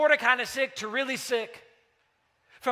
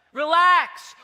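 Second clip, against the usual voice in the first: louder, higher coaxing command; dad urging son, louder and higher, to relax; firm relax; 5 semitones higher, 8 dB louder than usual. Retold or imitated. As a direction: snap him out of his head. Dad calming a tense son.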